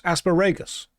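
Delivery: "Asparagus" stressed as as-pa-RAY-gus; mock mispronunciation. Shown as a demonstration. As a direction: mangle "Asparagus" on purpose. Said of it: "How do you say asparagus".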